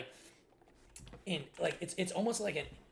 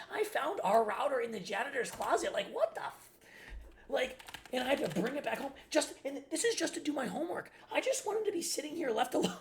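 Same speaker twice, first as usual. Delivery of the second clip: exasperated rant; 10 semitones higher than usual. Real, not acted. Son ranting about stepmother hiding his router, high and exasperated. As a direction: let the outrage spill upward.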